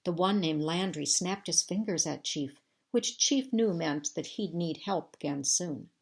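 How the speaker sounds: ordinary delivery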